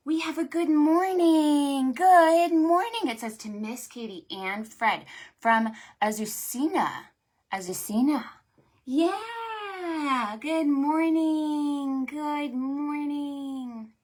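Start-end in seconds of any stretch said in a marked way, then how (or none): 0.1-3.0 bright, loud, high hello; excited greeting. Real, not acted. Librarian greeting kids on stream. 8.9-13.9 sing-song greeting. Real, not acted. Greeting child, drawn out.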